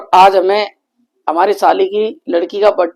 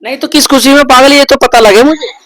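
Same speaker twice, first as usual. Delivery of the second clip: higher, louder challenge; heated husband snapping back; heated retort; 5 semitones higher, 9 dB louder than usual. Real, not acted. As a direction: demand to know why.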